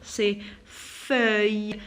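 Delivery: "feuille" very slowly with a y glide; drawn-out "feuille", no l sound. Shown as a demonstration. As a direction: stretch "C'est feuille", ending on a long y. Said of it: "je la prononce comme ya".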